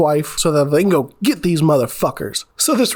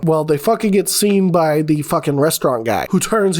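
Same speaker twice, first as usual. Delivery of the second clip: ordinary talk, nothing marked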